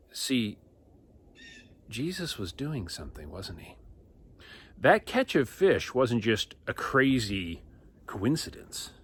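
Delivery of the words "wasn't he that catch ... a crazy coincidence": normal talking voice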